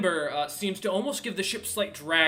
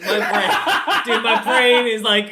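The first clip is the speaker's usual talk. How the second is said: laughing; 5 semitones higher, 13 dB louder than usual. Real, not acted. GM laughs at own blank.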